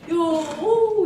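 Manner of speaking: gleeful whoop. Retold or imitated. Telling how happy she was, gleeful whoop. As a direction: whoop it out happily.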